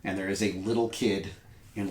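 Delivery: normal talking voice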